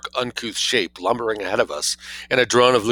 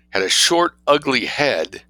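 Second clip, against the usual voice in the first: plain reading voice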